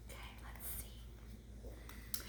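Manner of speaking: slow hushed whisper. Real, not acted. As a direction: whisper it slowly.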